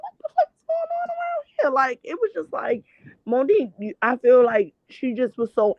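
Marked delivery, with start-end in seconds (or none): 0.0-1.7 squeaky high-pitched outrage, much higher than her usual voice; shocked disbelief. Retold or imitated. Retelling her reaction to Monique's comeback to Charlamagne, in a high, disbelieving squeal. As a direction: play up the disbelief in a shrill falsetto squeal. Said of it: "And I'm like".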